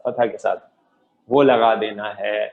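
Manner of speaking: ordinary talk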